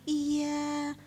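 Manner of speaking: a soft, overly gentle demo reply. Shown as a demonstration. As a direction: coo it gently like a meek answer. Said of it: "tidak selalu harus lemah lembut".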